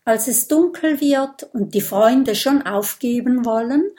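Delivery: usual speaking voice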